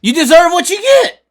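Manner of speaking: loud, high yell; shout. Real, not acted. Telling off complaining fans, a loud high shout. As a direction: shout it at them.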